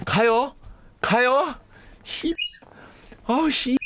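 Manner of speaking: high-pitched jittery hesitation; mock nervous. Cop psyching himself up to enter, mock scared. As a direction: act out a cop dreading going in.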